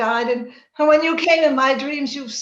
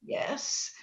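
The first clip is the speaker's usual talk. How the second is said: faint whisper; 12 dB quieter than usual. Acted. Dying Kathy pleads faintly.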